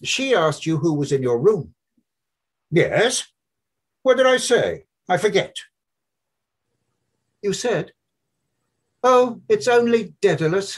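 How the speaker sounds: high, loud mocking young man's voice; Buck Mulligan. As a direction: play the brash medical student, needling his friend.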